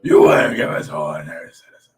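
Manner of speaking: loud, harsh growling voice; hammed-up rough hero voice; put-on vigilante growl. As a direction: growl it from the throat, straining the vocal cords. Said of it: "Why are you talking like that?".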